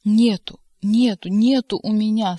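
loud desperate yell; terrible shout. A narrator's reading. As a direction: shout it in terror. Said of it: "страшным голосом прокричал". (страшный крик)

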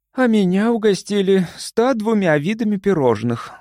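high, plaintive admission; groaning confession. A narrator's reading. Лили confessing a pastry binge, groaning. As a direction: moan it with mock suffering. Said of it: "со стоном призналась Лили".

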